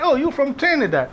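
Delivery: high-pitched quoted question; mock surprised guess. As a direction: imitate a stranger's sudden guess. Imitates people guessing his origin.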